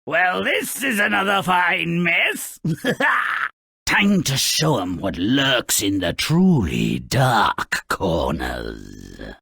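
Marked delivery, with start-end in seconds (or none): 2.6-3.5 a short sinister gloating laugh; evil chuckle. Acted. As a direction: chuckle like a villain pleased with himself. Villain narrator gloats.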